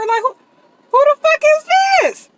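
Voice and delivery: high, loud furious yell; retold furious yell at the unknown texter; angry demand. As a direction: demand to know who it is. Retelling texting from unknown number.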